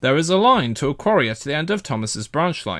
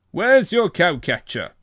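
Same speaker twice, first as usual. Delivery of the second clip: sharp question; 5 semitones higher than usual. A narrator's reading. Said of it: "he asked sharply".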